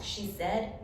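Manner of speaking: plain reading voice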